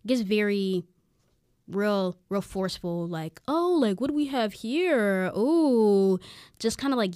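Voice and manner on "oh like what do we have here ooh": mock-yelled taunt, raised pitch; imitated heckling. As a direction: imitate a pest calling out mockingly. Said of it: "yelling in our faces".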